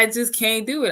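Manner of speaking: normal talking voice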